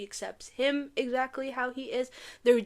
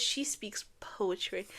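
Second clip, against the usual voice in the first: awed whisper; 3 semitones lower, 9 dB quieter, slower than usual. Real, not acted. Awed whisper about Amaya.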